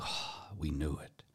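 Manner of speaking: soft, slow, knowing murmur among the pews; hushed knowing murmur. Retold or imitated. Congregation murmuring about a sinner, hushed and knowing. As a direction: murmur it like gossip confirmed.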